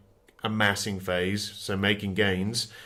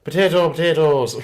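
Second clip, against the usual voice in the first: put-on playful Irish accent, higher and louder; hammed-up Irish accent for a laugh; 6 semitones higher, 6 dB louder than usual. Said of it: "Say something in an Irish accent".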